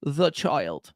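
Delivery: put-on The child in a light British accent; Russell Brand British accent. Streamer showing his Russell Brand impression to chat, light British accent. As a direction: repeat it as a playful British impression. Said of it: "my Russell Brand British accent".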